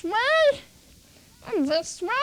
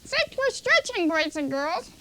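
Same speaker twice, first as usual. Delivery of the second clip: usual speaking voice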